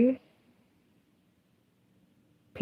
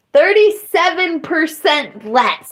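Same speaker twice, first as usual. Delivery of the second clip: yelling; 8 semitones higher than usual. Real, not acted. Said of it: "I'm yelling more than that now".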